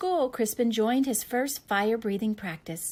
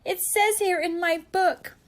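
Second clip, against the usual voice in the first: high, louder knight voice; whiny knight; 7 semitones higher, 6 dB louder than usual. Acted. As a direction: plead like a timid little knight. Knight explains his duty.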